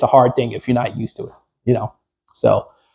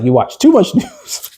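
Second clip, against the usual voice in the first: teasing wife; 10 semitones higher, slower than usual. Retold or imitated. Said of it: "my wife's like".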